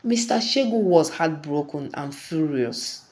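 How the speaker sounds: ordinary delivery, nothing marked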